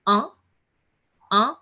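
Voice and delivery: "un" as one nasal vowel, no n; nasal French vowel. Shown as a demonstration. Teacher models French number one, nasal. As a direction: send "un" through the nose. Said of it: "A nasal sound is there".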